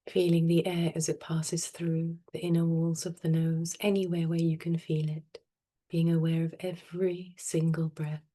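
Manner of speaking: soft, unhurried meditation voice with long pauses; guided meditation. Real, not acted. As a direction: gently guide them into stillness, phrase by phrase. Leads a breathing meditation.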